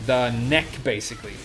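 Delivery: ordinary talk, nothing marked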